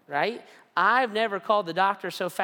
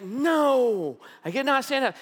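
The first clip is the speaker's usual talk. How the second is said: mock wail; 7 semitones higher, 4 dB louder than usual. Retold complaint to wife.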